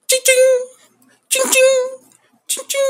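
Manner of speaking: high squeaky coin jingle sounds; coin clinking sound. Retold or imitated. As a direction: strain for a thin high voice. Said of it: "não consigo fazer voz fina".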